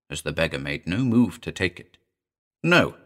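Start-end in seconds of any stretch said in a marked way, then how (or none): 2.6-3.0 sharp, high, loud refusal; snapped no. A narrator's reading. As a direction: bite the word off angrily. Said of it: "snapped the blind woman".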